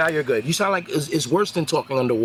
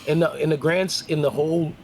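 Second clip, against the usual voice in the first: usual speaking voice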